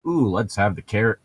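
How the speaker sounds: normal talking voice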